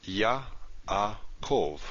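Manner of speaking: Yaakov split slowly as Ya-a-kov; syllable by syllable. Shown as a demonstration. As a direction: pause at each syllable break in Yaakov. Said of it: "we have a syllable division here".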